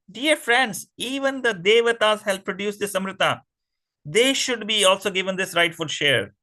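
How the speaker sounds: a weak demon's sweet, high appeal to friends; pleading demon. Acted. Weaker demons sweetly urge the strong ones to share, pleading voice. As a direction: coax the others into sharing.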